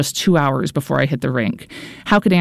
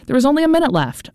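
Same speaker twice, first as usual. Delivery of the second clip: higher and fast, urgent; urgent rush; 10 semitones higher, faster than usual. A narrator's reading. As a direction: race through it, breathless. Frantic final push.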